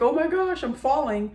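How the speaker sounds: high-pitched pretend panic; lightly hammed-up alarm; mock alarm. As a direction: imitate someone suddenly falling, pitch up. Acting out the staged fall.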